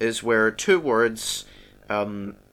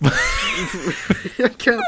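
laughing fit. Real, not acted.

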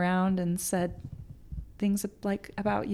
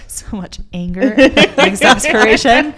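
laughing outburst; 3 semitones higher, 17 dB louder than usual. Real, not acted. Laughing exclamation about treatment work.

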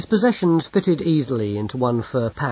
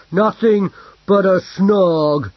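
louder, slow growl; 6 semitones higher, 7 dB louder, slower than usual. A narrator's reading. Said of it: "he growled".

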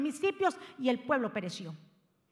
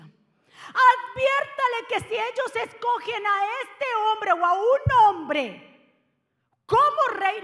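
drawn-out, louder and higher warning; emphatic slow warning; 7 semitones higher, 7 dB louder, slower than usual. Real, not acted. Pastor driving God's warning home to the congregation, loud and slow. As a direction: stretch every word and press the warning home.

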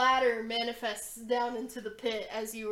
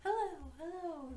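high, softer echo of a shout; 6 semitones higher, 4 dB quieter than usual. Acted. Pit throws the player's hello back, echoing. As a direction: repeat it faintly like an echo from a pit. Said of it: "the echoes back".

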